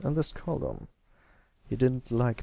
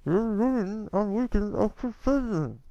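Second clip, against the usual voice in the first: talking through clenched teeth; 11 semitones higher than usual. Acted. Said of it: "holding back Scootaloo by the tail, using her teeth".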